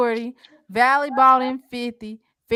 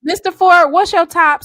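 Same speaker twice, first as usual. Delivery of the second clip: loud, higher call across the panel; 7 semitones higher, 10 dB louder than usual. Real, not acted. Host calls out to Mister Ford, loud. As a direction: call his name out loud to get his attention.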